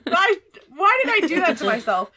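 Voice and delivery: fast, high, loud through laughter; laughing. Real, not acted. Game master regrets their own confusing names, laughing. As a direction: crack up at your own mistake.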